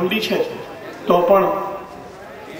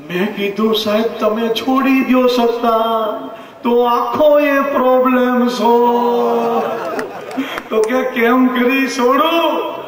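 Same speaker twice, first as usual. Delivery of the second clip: lilting, half-sung comic verse, pitched up; satirical poem recital; 5 semitones higher than usual. Acted. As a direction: recite it like a mocking folk song to the crowd. (વ્યંગ્ય કાવ્યનું લયબદ્ધ પઠન)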